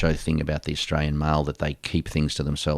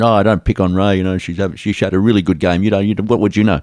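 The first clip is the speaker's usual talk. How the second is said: louder, higher retold protest; mock telling-off, pitched up and loud; indignant fan; 4 semitones higher, 7 dB louder than usual. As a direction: raise the voice as a fan sticking up for someone. Retold fans scolding a commentator.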